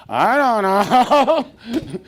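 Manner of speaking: drawn-out, high-pitched don't know; exaggerated, sing-out puzzlement; mock cluelessness. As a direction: stretch it way out, playing clueless. Mock answer to own question.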